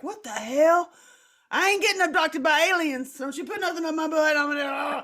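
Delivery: loud defiant protest to the aliens; rebellious shouting. Retold or imitated. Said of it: "I'm a rebel who I'm like".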